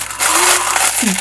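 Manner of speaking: loud high gasp of delight; excited gasp. Real, not acted. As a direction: gasp as the gift is revealed. Opening a surprise gift.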